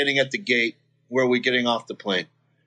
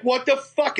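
higher, outraged what the fuck; indignant yell; 10 semitones higher than usual. Retold or imitated. Drunk Gary objecting to the commotion, high outraged protest.

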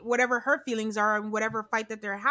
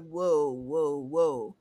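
low, drawn-out startled whoa; low uneasy whoa whoa whoa; alarmed whoa; 8 semitones lower, much slower than usual. Retold or imitated. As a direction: back off from something scary. Reacting to a dog's snarl.

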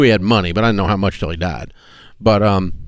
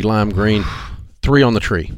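ordinary talk

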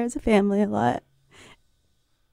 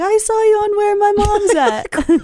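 dopey fan voice; 11 semitones higher than usual. Retold or imitated. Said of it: "a lot of guys that are like".

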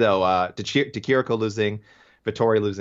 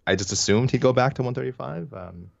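normal talking voice

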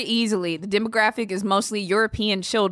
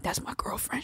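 breathy mock whisper of a smitten fan; 4 semitones higher, 11 dB quieter than usual. Lonely fan whispering about his online crush, mock whisper. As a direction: whisper it like a lonely fan's secret. Fan's devoted thoughts about [name].

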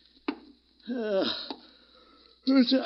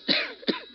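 put-on old man's cough. Sick old man coughing.

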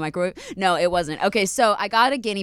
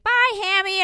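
high, sing-song goodbye, much higher; cheerful grandpa; 13 semitones higher, slower than usual. Retold or imitated. Grandpa saying goodbye to the dead hamster, cheerfully oblivious. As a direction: wave the hamster off brightly. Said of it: "my grandpa's like".

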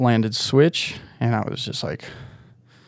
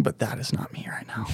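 hushed, breathy, much softer; whispered aside; 11 dB quieter than usual. Retold or imitated. Turning down Bryden's next trick, a hushed whisper. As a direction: drop to a whisper to say you're done. Retold reply to Bryden, trailing off.

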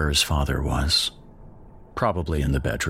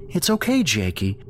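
higher, gentle motherly comfort; soothing mother; 9 semitones higher than usual. Acted. Mom comforting her crying son, soothing. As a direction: soothe a frightened little boy.

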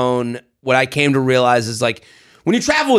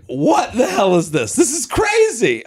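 mock disbelief; 6 semitones higher than usual. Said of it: "I was like".

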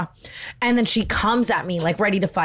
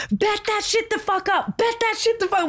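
retold screaming; 13 semitones higher than usual. Retelling a fight with a girl at a bar, high mock scream. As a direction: imitate your own shrieked challenge, pitched way up. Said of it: "all I'm screaming is".

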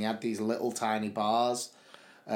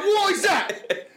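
high-pitched, drawn-out mock panic; panicked shout; 4 dB louder, much slower than usual. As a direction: imitate a horrified mate, slow and very high. Retelling friend's panic at the barrier.

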